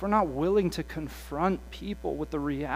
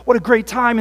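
loud, high gushing delight; gushing student; 7 semitones higher, 12 dB louder than usual. Retold or imitated. Students back from church camp, loud and gushing. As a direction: gush about camp like a hyped teen.

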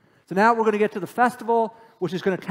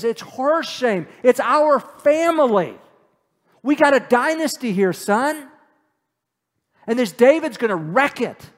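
furious father; 5 semitones higher, 6 dB louder than usual. Acted. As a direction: pound each line at the son like an enraged king. Saul berates Jonathan over David.

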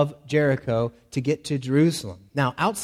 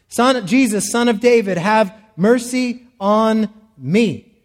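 loud, higher, slow desperate plea; crying out; 5 semitones higher, 5 dB louder than usual. Shown as a demonstration. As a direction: call out over the crowd, drawing out the mercy. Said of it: "He cries out".

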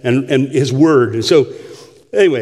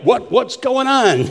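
higher, drawn-out astonished question; startled congregation; 6 semitones higher, slower than usual. Acted. Preacher voicing churchgoers who saw the demon cast out, mock astonishment. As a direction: act out bewilderment, stretching the words high. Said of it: "They're like".

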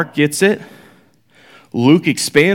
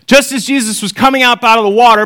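raised, brisk Bible reading voice; scripture reading; 5 semitones higher, faster than usual. Acted. Teacher reading the gospel to the class, lifted reading voice. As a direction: read the passage out to the class.

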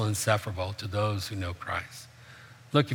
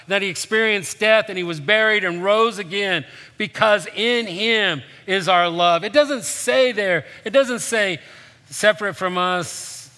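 higher and louder, pressing each phrase; impassioned preaching; 7 semitones higher, 4 dB louder than usual. Acted. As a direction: preach the point home to the church. Preaching climax.

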